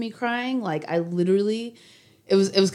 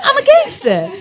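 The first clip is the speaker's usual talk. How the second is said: higher, louder laughing repeat; laughing boast; 7 dB louder than usual. Real, not acted. To friends, insisting she is tough, laughing loudly. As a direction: laugh it out big, pushing the claim.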